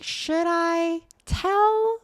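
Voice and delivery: high, drawn-out worried wondering; mock dramatic dilemma. Grace wondering aloud whether to tell, high and slow. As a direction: stretch it out high like she's torn. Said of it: "she's like".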